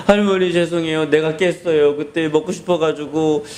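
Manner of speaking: mock-tearful child confession; acted crying confession. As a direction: confess like a sorry crying kid. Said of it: "막 울면서".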